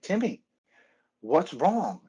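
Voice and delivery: high, startled call of his name; a father figure suddenly alarmed, calling out; worried surprise. Retold or imitated. Old man sees crying friend.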